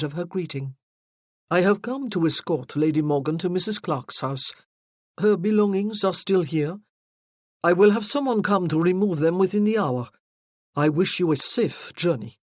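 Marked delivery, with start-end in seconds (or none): 1.5-12.4 put-on light French accent, charming but firm; a hint of French, steel under the charm. A narrator's reading. Rosthorn firmly tells Lady Caddock he escorts Morgan, French accent. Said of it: "His French accent was quite pronounced".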